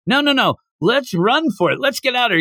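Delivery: high-pitched urgent pleading; mock panic. Voicing Seda urging escape.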